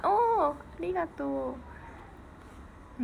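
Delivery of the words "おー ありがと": ordinary talk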